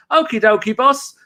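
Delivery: high, meek okie dokie; chirpy obedient defender; mock Mustafi. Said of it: "Mustafi goes".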